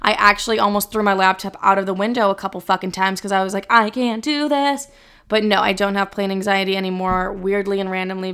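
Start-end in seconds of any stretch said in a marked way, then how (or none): 3.7-4.9 retold panic. Retelling plane anxiety outburst.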